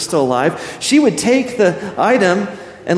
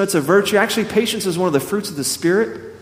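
usual speaking voice

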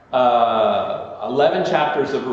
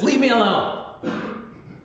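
slow, high, hurt plea as God pleads; drawn-out wounded leave me alone; 11 semitones higher, much slower than usual. Retold or imitated. Betrayed lover pleading to be left alone, slow and high. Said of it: "he says".

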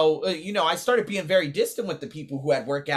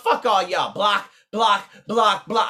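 mock angry shout; 5 semitones higher, 6 dB louder, slower than usual. Retelling blocking everyone.

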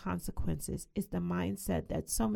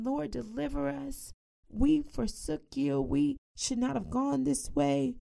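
mock-pleading, higher voice; pleading cry; 7 semitones higher than usual. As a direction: cry out to the Lord for rescue. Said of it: "So they're crying".

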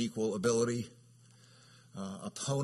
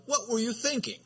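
high, exasperated parent's question; mock exasperated parent; parent scolding; 11 semitones higher, 3 dB louder than usual. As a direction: scold a child with a rhetorical question. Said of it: "a rhetorical question like".

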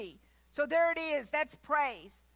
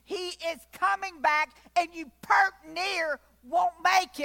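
louder, urgent preacher's warning; forceful preaching; 4 semitones higher, 5 dB louder than usual. Acted. Preacher warning the congregation to get saved, forceful preaching. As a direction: press the warning home from the pulpit.

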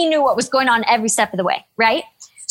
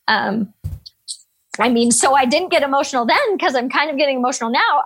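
ordinary delivery

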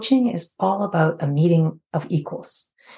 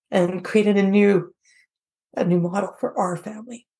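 holding back tears, voice wavering; fighting tears, higher and strained; shaky sigh; 5 semitones higher than usual. Real, not acted. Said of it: "I'm gonna try not to cry".

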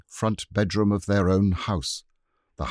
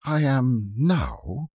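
slow and higher, thinking it over; slowly; 7 semitones higher, slower than usual. Acted. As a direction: take your time weighing it. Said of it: "said Anthea slowly".

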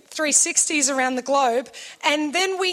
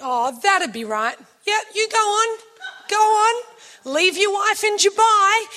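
bitter mock send-off; sarcastic attitude; 6 semitones higher than usual. Wife snapping at husband running ahead, sarcastic. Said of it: "I start getting an attitude at Luke".

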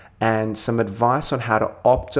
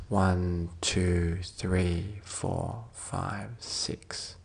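low, softening count, drawn out; soft breath count; 3 semitones lower, 8 dB quieter, much slower than usual. Real, not acted. Counting six for the listener's out-breath, soft and fading. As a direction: count down the exhale, fading to a whisper.

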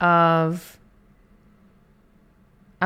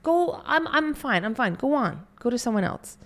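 much higher, fluttery mock protest; self-denying refusal; 9 semitones higher than usual. Person refusing the universe's gift, much higher fluttery protest. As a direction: wave off the gift, insisting you're fine. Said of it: "Where we go".

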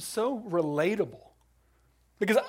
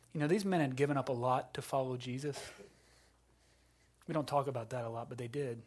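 ordinary talk

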